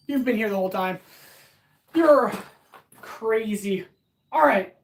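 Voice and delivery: ordinary talk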